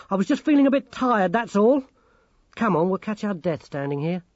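high-pitched, insistent character voice, easing at the end; defensive Grimmer. Acted. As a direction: brush it off defensively, pitched well up. Voices Grimmer protesting, then urging.

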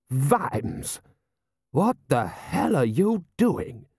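slow, high-pitched indignation; outraged lord. Acted. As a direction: demand an explanation in drawn-out outrage. Lord Rust glares, confronts Vimes.

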